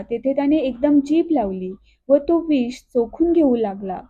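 ordinary delivery, nothing marked

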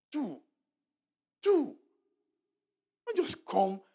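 one sharp commanding cry; emphatic shout. Real, not acted. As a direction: shout it out, high and loud. Preacher urging the congregation.

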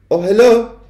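high, loud, breezy greeting; cool grandmother. A narrator's reading. Grandmother greeting the witch from above, coolly. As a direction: greet brightly, as if nothing is amiss. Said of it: "coolness of her voice".

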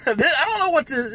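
words breaking into a real laugh; disbelieving laugh mid-sentence; laughing in disbelief. Real, not acted. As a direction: laugh through it, can't believe it.

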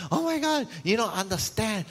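high-pitched amazed outburst; excited high exclaim. Retold or imitated. Husband stunned at his wife, high amazed outburst. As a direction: blurt it out amazed. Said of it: "he's like".

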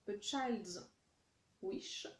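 English product name in a French accent. Real, not acted. Vlogger reading candle names to viewers, French-accented English. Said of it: "vous avez mon super accent".

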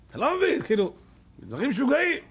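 you just can't believe it; amazed. Real, not acted. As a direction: marvel out loud at a crazy thing. Amazed at the fax machine.

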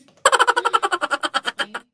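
high, loud laughing outburst; loud burst of laughter. Real, not acted.

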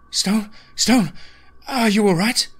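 high-pitched female character voice; worried woman. Acted. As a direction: call to an injured friend, high and loud. Narrator voices Erin, worried, checking Stone.

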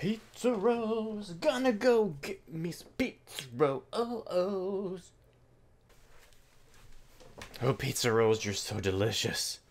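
slow, swooning over snacks; sultry food voice. Pretend. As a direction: croon to the pizza like a lover. Swooning over pizza rolls.

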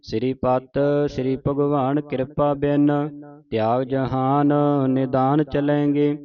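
measured chant-like verse reading; sustained teaching recitation; scripture recitation. Shown as a demonstration. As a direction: recite in phrases, holding the long vowels.

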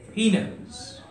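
whisper. Acted. Whispered line inside poem.

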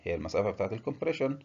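usual speaking voice

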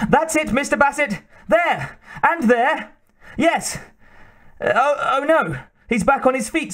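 high-pitched, fussing manservant; excitable servant, eagerly urging. Acted. Hotchkiss dancing around his duelling master, excited high servant voice. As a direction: cheer on the master in a high, agitated voice.

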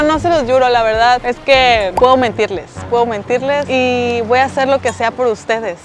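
ordinary talk

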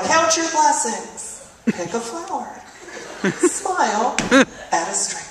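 slow, sugary greeting-card reading; sweet inspirational tone. Retold or imitated. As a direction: read it like a sappy chain email. Inspirational email read sweetly.

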